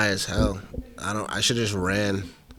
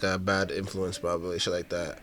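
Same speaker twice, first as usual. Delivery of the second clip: ordinary delivery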